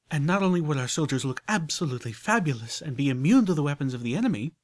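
high, loud, glowing with her idea; rapturous lady. Acted. Rarity pitching soul armor to Applejack, rapturous and loud. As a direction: sell the vision with rapture.

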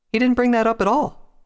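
high, loud denial; insistent. A narrator's reading. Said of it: "Twilight insisted".

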